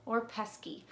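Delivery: put-on lively storybook reading for kids; reading to children. A narrator's reading. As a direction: read it like story time. Reads a picture book aloud.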